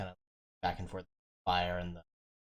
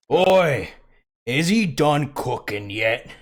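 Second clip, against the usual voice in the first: loud, higher hungry orc call; ork sesi; 5 semitones higher, 10 dB louder, much slower than usual. Acted. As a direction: holler across camp like a second orc. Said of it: "kinda push through the tree lines and go".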